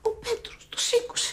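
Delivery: slow, hushed whisper; whispering that Peter answered. Real, not acted. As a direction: whisper it slowly to her friend. Secret phone call, hiding.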